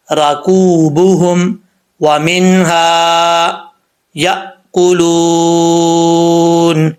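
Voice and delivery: slow, measured tajweed recitation; Quran recitation. Acted. Teacher reciting the verse for students to follow, slow tajweed. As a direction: chant each word slowly with full vowels.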